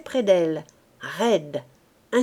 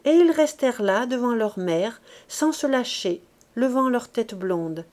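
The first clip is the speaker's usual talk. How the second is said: ordinary delivery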